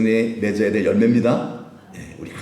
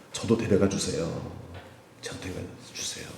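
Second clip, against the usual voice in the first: fading plea; 4 semitones lower, 10 dB quieter than usual. Acted. Thief pleads, fading.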